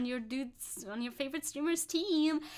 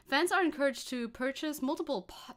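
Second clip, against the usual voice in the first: normal talking voice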